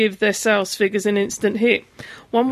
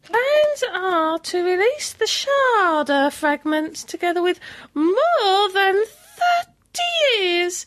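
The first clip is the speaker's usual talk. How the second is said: voice breaking; 7 semitones higher, slower than usual. Real, not acted. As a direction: voice cracking up high, slowed. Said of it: "my voice broke".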